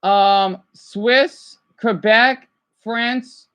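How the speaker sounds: ordinary talk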